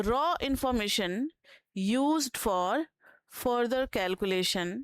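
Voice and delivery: slow, measured dictation voice. Acted. Teacher dictating a definition to students, slow dictation. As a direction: spell it out phrase by phrase for note-takers.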